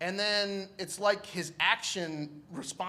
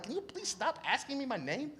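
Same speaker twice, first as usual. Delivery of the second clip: high, softened mock exasperation; exasperated plea; 8 semitones higher, 5 dB quieter than usual. Patient begs hospital staff to stop asking, high exasperation. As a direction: plead high, tired of being quizzed.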